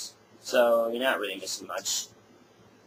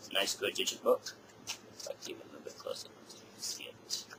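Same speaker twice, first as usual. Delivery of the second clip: whispering; 3 semitones lower, 12 dB quieter than usual. Real, not acted. Leaning close to show booklet.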